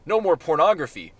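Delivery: usual speaking voice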